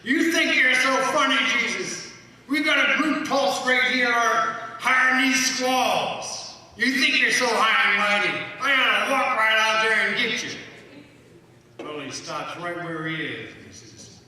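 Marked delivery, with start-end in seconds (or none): 0.0-10.5 loud, higher, taunting call; angry shout. Retold or imitated. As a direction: shout him down in anger. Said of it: "called out to him".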